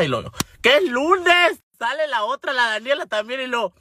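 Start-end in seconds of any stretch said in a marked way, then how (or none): 0.6-1.6 réplica aguda y exasperada; exasperación imitada. Retold or imitated. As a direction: contesta harta, subiendo mucho la voz. (exasperada, en tono agudo)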